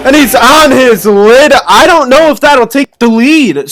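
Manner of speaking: amped-up arena play-by-play; sports commentary. Acted. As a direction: call it like a live announcer. Live play-by-play over show footage.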